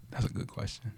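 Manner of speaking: ordinary talk, nothing marked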